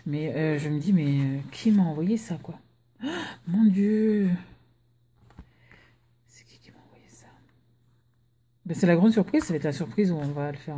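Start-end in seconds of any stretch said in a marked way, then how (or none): 3.0-4.3 slow, drawn-out astonishment; gasp, then stretch the words out; real stunned surprise; amazed gasp. Real, not acted. Opens a surprise parcel. 6.3-7.3 quick, barely audible whispered question; hushed, rapid aside to oneself; astonished whisper. Real, not acted. Wonders who sent the parcel.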